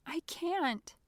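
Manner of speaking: high, small squeaked protest. A narrator's reading. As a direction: squeak it out in a tiny voice. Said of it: "I squeaked".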